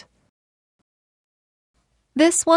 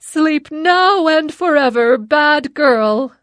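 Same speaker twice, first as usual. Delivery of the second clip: louder, higher gloating witch; loud witch cry; 4 semitones higher, 5 dB louder than usual. A narrator's reading. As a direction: crow it out triumphantly. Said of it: "The witch laughed loudly and said".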